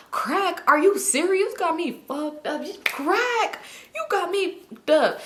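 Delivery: high-pitched imitation of indignant disbelief; mock offended. Man denying it is crack to Simone, high mock offence. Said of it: "He must be real offended".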